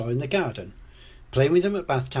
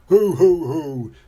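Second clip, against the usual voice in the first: loud, higher "hoo hoo hoo"; acted chuckle; 5 semitones higher, 9 dB louder, slower than usual. A narrator's reading. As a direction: chuckle like a jolly storybook dad. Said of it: "chuckles Daddy Pig".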